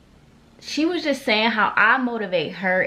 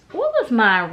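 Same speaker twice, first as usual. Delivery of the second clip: higher, louder repeat of the question; asking aloud; 5 semitones higher, 5 dB louder than usual. Real, not acted. Vlogger asks again for her award name, asking aloud. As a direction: throw the question out to the room.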